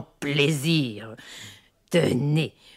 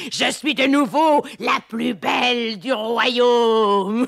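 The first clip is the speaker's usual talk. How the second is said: high, loud words through cackling laughter; laughing triumph; 7 semitones higher, 4 dB louder than usual. Acted. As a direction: crow it out while laughing.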